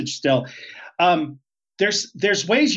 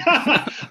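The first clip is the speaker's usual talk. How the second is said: short higher-pitched laugh; real burst of laughter after joking; amused laugh; 10 semitones higher than usual. Real, not acted. Guest kidding the host about coffee, amused laugh.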